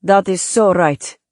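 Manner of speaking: put-on slow, deliberate Polish accent; thick Polish accent. A narrator's reading. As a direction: agree grandly in a heavy Polish accent. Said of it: "said in her thick Polish accent".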